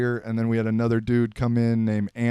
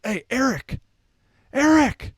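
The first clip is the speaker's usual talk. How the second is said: high, loud call across practice; coach yelling; 14 semitones higher, 4 dB louder than usual. Retold or imitated. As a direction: shout the name like a coach. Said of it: "yelling at Big E".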